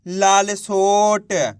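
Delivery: very drawn-out model word; slow blended reading. Shown as a demonstration. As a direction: stretch every syllable while joining them into one word. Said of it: "तो मिलके बन जाएगा". (अक्षर मिलाकर खूब खींचकर पढ़ना)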